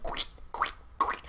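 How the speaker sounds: tiny soft squelches; squishy sounds. Retold or imitated. Imitating Baby Groot's soggy shoes for viewers, squishy sound effect. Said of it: "making the little squishy sounds".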